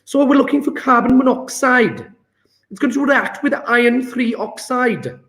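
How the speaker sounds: Welsh accent. Shown as a demonstration. Chemistry tutor trying the question in a Welsh accent. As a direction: have a go at a Welsh lilt. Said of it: "So try Welsh accent".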